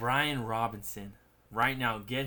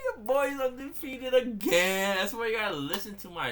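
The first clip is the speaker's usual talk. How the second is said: excited bragging; 11 semitones higher, 6 dB louder than usual. Real, not acted. Bragging about undefeated team.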